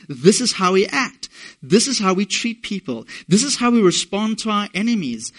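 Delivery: fervent sermon climax; rising preaching build. Acted. Preaching build with repeated phrases.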